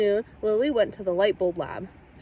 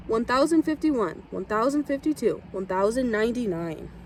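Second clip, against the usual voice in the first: plain reading voice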